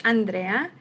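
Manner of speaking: Andrea said the Spanish way, ahn-DREH-ah; Spanish pronunciation. Shown as a demonstration. Speaker showing her name as meant, "Andrea" in Spanish pronunciation. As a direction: say "Andrea" with Spanish vowels and tapped r. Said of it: "like in Spanish".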